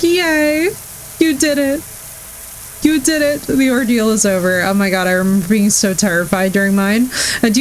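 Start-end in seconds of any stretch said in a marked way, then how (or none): 0.0-4.6 excited cheer. Retold or imitated. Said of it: "I'm like".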